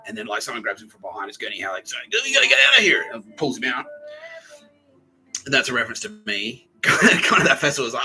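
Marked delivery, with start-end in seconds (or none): 1.9-3.1 loud, high urgent yell; louder and higher rescuer's shout. Retold or imitated. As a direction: yank him out with a yell. Rescuer pulling him out.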